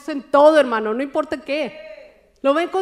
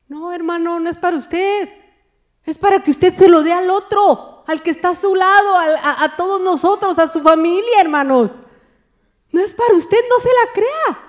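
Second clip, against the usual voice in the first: higher and louder, driving the point home; fiery preacher calling out the congregation; full-force preaching; 6 semitones higher, 7 dB louder than usual. Acted. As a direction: preach it hard at the whole church.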